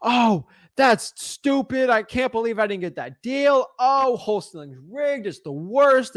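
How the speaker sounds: hammed-up tantrum, pitch up, slow sulky start; mock whiny rookie wholesaler. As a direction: whine like a sore loser who got outbid. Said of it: "you're kicking and screaming, saying".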